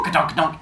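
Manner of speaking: unvoiced ka-dunk thuds; whispered bump noise. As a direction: mimic tires bumping over. Bus runs over her.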